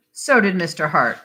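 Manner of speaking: plain reading voice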